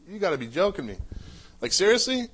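you can't believe what you just heard; incredulous. Retold or imitated. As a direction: pitch climbing high in disbelief. Disbelief at stranger's question.

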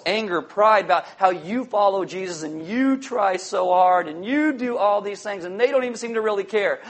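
usual speaking voice

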